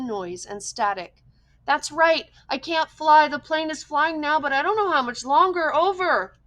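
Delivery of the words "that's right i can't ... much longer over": high, loud, tense reply; urgent answer. A narrator's reading. Stranded boy tells the operator he can't fly, tense and high. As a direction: push the words out fast and scared.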